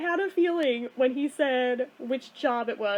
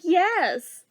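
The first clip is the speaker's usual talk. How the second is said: higher, louder delighted exclamation; excited yes; 5 semitones higher, 5 dB louder than usual. Retold or imitated. Answering coworker about the sister, excited. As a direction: burst out as the coworker guesses right.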